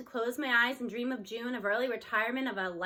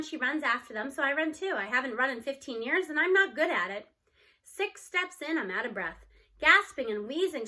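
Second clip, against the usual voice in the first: plain reading voice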